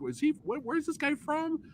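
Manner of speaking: high, baffled question; puzzled asking. Retold or imitated. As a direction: ask it like you can't place the accent at all. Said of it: "We're like".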